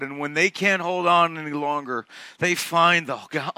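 ordinary talk, nothing marked

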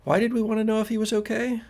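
quick, high puzzled question; puzzled girl. Acted. Girl turns back to her companion, confused.

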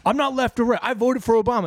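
high-pitched imitation of insistence; mock defensive protest. Golf tournament guy defends himself, mock protest. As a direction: mimic someone insisting on his politics. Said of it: "doing the same thing you're doing".

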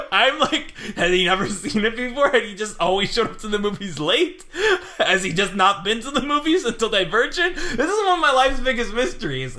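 high, laughing disbelief. Real, not acted. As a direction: laugh through the words. Laughing telling funny story.

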